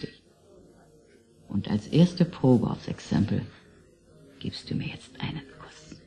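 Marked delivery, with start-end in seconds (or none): 4.4-6.0 a teasing come-on, all in whisper; whispered seduction in his ear; seductive whisper. Acted. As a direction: whisper the invitation intimately. Asking him for a kiss.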